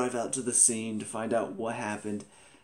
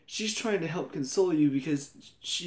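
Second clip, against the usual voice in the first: normal talking voice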